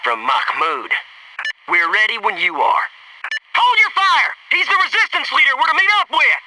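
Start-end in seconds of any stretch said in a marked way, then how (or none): 3.5-6.4 higher, sharp call to stop shooting; urgent shout. Acted. Soldier to his squad, urgent high call to hold fire. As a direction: yell it to halt the squad.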